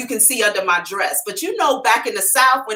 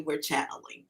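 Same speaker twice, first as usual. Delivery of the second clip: much softer and lower, gently; gentle voice; 6 semitones lower, 15 dB quieter than usual. Retold or imitated. Spirits explaining themselves to the psychic, gentle hushed voice. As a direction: imitate a soft, unbothered answer. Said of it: "All gently".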